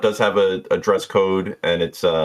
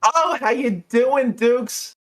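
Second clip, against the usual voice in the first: high, slow, doting voice; gushing bartender; 13 semitones higher, slower than usual. Acted. Jeannie greeting Dukes on the phone, high and doting. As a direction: coo over an old favourite.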